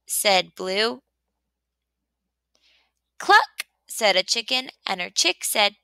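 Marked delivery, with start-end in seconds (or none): 3.2-3.7 high sharp cluck; hen cluck. A narrator's reading. The chicken clucks hello to Blue in a high cluck.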